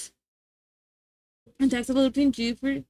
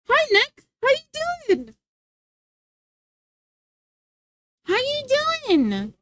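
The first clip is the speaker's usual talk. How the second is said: cheerful greeting; 10 semitones higher, slower than usual. Real, not acted.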